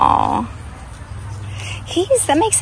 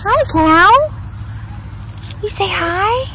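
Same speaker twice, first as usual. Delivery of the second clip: high and sweet, coaxing a little one; 10 semitones higher than usual. Real, not acted. Prompting toddler to greet cow.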